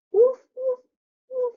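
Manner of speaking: high-pitched playful dog bark; imitated dog woofs. Shown as a demonstration. Teacher showing a pupil the sound of a dog, imitated woofs. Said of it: "the sound of a dog".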